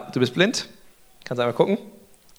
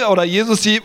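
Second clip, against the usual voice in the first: ordinary talk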